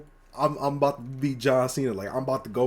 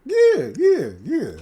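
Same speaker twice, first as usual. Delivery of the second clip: high hyped yeahs; excited yeah; 11 semitones higher, 5 dB louder, much slower than usual. Real, not acted. Eager friend shouting yes to a question. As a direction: jump in loud and high, all in.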